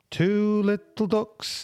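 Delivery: slow bingo call; bingo caller. Shown as a demonstration.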